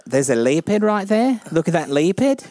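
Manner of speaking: put-on New Zealand accent, leopard as lepid. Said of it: "I do do New Zealander".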